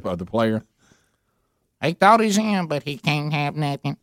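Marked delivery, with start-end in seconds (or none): 1.8-4.0 high, slow robotic call; mock robot line judge scolding; robot umpire voice. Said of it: "what if Gary did the one".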